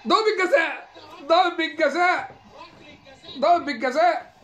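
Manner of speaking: high, loud yells of penalty; excited shout. Real, not acted. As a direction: erupt like the stadium just went wild. Penalty given, he erupts.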